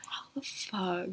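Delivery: stunned, voiceless whisper; distraught. Retold or imitated. Fan recalls being recognized, distraught whisper. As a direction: whisper it in overwhelmed disbelief. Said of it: "so distraught".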